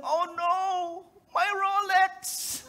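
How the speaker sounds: high, slow wail of dismay; dismayed punchline. Retold or imitated. Injured man to medic, hammed-up dismay over Rolex. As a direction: moan for the lost watch like a tragedy. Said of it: "And he said".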